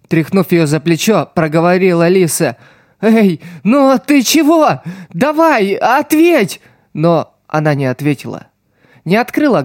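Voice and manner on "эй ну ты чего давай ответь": desperate plea. A narrator's reading. Boy begs her to answer.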